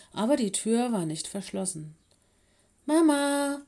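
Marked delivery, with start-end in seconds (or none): 2.9-3.6 loud, higher call for mother; child calling for his mother. A narrator's reading. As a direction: call out for mum through the house. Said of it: "rief er".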